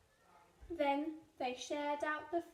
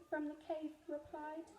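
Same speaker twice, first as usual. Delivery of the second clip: ordinary delivery, nothing marked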